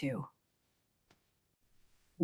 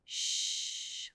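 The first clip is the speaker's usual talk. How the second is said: hush; 12 dB quieter than usual. Acted. Mom hushing child.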